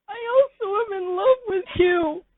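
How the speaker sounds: very high, wailing whine of the listener; high crying voice. Acted. The listener confessing to Pimpich in a high crying voice. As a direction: squeak it out like a crybaby.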